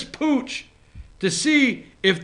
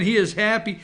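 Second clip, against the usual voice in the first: ordinary talk, nothing marked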